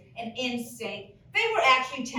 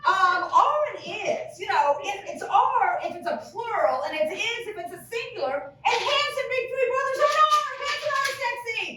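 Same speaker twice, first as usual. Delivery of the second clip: mock grammar lecture; 10 semitones higher than usual. Comic lectures grammar-correcting mom, laughing. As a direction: lecture her with mock authority. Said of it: "I'm like".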